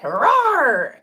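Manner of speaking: mock "rawr" with a big swoop up and down; playful growling roar. Showing how feisty she's become online, a playful roar.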